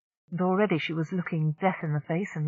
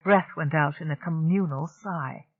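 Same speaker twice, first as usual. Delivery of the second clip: ordinary delivery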